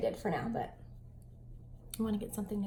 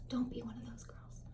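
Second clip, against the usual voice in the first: soft whispered aside; whispered warning; 10 dB quieter than usual. Real, not acted. Warning about mismatched neck.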